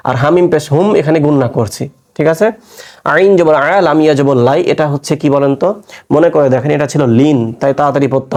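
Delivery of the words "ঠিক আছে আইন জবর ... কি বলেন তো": normal talking voice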